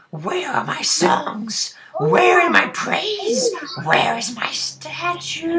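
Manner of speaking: high, loud, slow wail of outrage; enraged ghost. Acted. Ghost demanding praise from the party, enraged high shriek. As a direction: demand it, climbing to a drawn-out shriek. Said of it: "as she is enraged".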